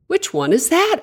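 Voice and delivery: high-pitched eager question; excited. A narrator's reading. Igor asks the captain about the ship, excitedly. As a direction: ask eagerly, with the pitch jumping up. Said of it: "asked Igor excitedly".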